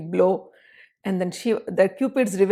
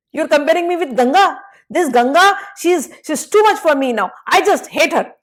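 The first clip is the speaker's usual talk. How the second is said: flustered defense; 7 semitones higher, 5 dB louder than usual. Acted. Shiva defends, quarrel continues.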